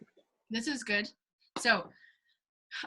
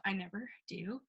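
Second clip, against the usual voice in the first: whisper; 10 dB quieter than usual. Real, not acted. Whispered aside.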